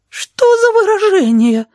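very high, slow, scandalized cry; gasping shocked sheep. A narrator's reading. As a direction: gasp it out in a tiny appalled voice. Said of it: "ахнула овца". (овца ахает тонким голосом)

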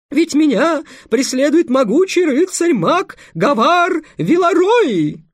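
high loud falsetto princess; boy playing a girl. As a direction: overact the lady in falsetto. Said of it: "срывающимся фальцетом". (мальчик пищит фальцетом за княгиню)

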